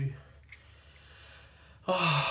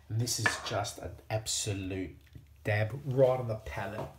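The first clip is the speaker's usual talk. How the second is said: ordinary talk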